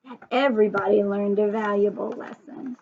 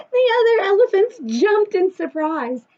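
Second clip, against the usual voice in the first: excited narration; 7 semitones higher, 4 dB louder than usual. A narrator's reading. Narrating the startled herd.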